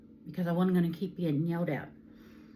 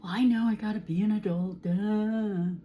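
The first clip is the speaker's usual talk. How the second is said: mock the daughter with a sarcastic duh; sarcastic mimic; 3 semitones higher, 6 dB louder than usual. Mother mimicking her daughter's sarcastic comeback.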